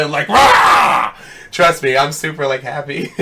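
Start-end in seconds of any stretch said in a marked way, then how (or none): 0.3-1.1 loud, sudden fake rage roar; mock angry roar. Shown as a demonstration. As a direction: burst out with a playful mad yell. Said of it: "If I was mad, you'd see me all, like, red".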